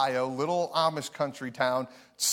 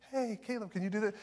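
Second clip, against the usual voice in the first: higher, softer sweet aunt voice; sweetly ask, all niceness; the kind aunt before she snaps; 6 semitones higher, 6 dB quieter, faster than usual. Retold or imitated. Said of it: "She has a very soft kind of voice".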